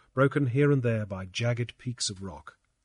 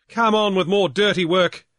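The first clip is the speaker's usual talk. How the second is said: loud, high defiant challenge; Pankburn defiant; 8 semitones higher, 11 dB louder than usual. Acted. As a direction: throw down the challenge. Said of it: "he told Grief".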